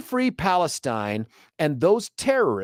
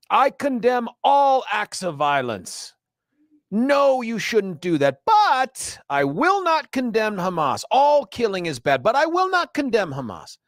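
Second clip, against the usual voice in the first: mocking caller impression, pitched much higher; imitating a caller; 7 semitones higher than usual. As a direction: parody the caller, voice raised high and whiny-sounding in pitch only. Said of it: "sound remarkably like, uh, Bamba".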